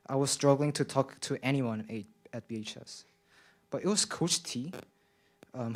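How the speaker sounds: ordinary delivery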